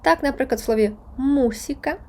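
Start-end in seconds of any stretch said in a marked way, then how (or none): 1.2-2.0 мусіка stressed on its first syllable. Shown as a demonstration. Teacher explaining the written accent, мусіка stressed on u. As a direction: put the weight on mu in мусіка. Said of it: "наголос стоїть графічний над літерою У".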